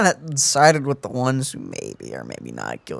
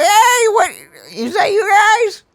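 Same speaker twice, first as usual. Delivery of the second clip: much higher, louder, drawn-out mock greeting; mock surprised arrival; 8 dB louder, slower than usual. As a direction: play him strolling in all surprised. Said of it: "He just walks in like".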